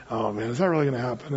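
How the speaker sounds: soft, fast mumbled doubt; half under the breath, quick and unsure; doubting mutter. Retold or imitated. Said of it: "just saying".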